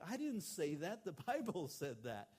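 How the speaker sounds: high, loud, with a laugh on "Bible"; laughing retort. Retold or imitated. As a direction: toss it back with a chuckle. Pastor defends sermon, laughing.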